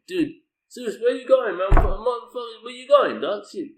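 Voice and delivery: put-on high, loud alarmed yell at a child walking off; yelling after son. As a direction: shout after him in disbelief. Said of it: "I'm like".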